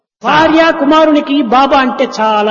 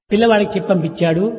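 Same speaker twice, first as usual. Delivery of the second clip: normal talking voice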